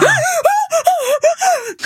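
huge high gasp that breaks into a shriek; retelling a jump scare, she gasps and cries out; startled gasp. Retold or imitated. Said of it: "And I went".

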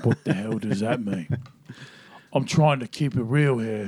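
put-on low, soft English accent; deep English accent. Showing the accent practised while driving, deep English voice. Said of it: "English accent".

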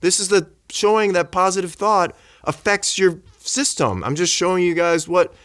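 high-pitched insistent protest; exasperated retold defence. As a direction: argue the case to doubters, re-enacted. Retold argument with sceptical doctors.

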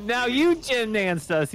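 high, slow indignant cry; mock outrage. Real, not acted. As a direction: cry out at the cheap trick.

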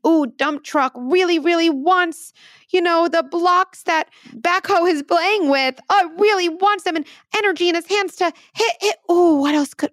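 high, animated toy-story voice; pretend-play narrator. Parent playing out hitting with trucks, high animated narration. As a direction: build the truck's urge in a high playtime voice.

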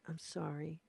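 soft, deflated demonstration of sorry; powerless apology. Shown as a demonstration. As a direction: let the power drain out as you apologize. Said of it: "we hear it in the voice tone".

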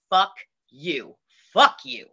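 retold cursing, only mildly heated; a touch of mock fury; angry retort. To Donnie, who volunteered her, mildly angry. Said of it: "I was so mad".

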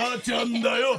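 comic insistent shout. Acted. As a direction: insist on the character name in a put-on yell. Insists on the character's name.

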